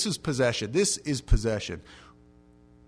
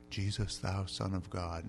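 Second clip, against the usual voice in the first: soft, low, slow recitation; mild King's language; 5 semitones lower, 8 dB quieter than usual. Acted. Possessed man wrongly imagined speaking King's English, soft and stately. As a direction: say it politely, far too composed. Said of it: "they don't speak the king's language".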